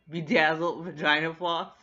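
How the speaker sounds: loud, laughing through the phrase; can't get it out without laughing; cracking up. Real, not acted. Vlogger repeating the line she loves, laughing loudly. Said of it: "Even saying it was making me laugh".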